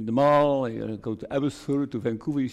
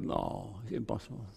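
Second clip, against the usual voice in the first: quiet inner voice; 4 semitones lower, 11 dB quieter than usual. Retold or imitated. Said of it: "I thought".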